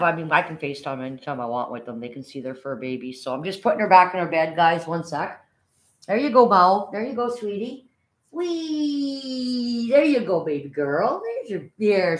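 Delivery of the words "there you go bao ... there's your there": higher coaxing pet talk; grandma cooing at the dog as she tucks her in. Real, not acted. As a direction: coax her gently into her bed. Coos to the dog, settling her.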